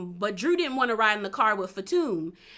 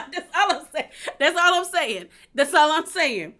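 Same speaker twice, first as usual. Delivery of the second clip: laughing; 5 semitones higher, 5 dB louder than usual. Real, not acted. Laughing at her own point.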